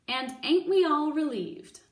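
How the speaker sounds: sarcastic cook. Acted.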